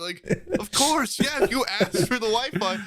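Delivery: imitating himself snapping back at the dad; mock angry rant. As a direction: rant like an offended teen. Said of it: "he went off and was like".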